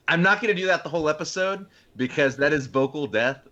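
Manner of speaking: ordinary talk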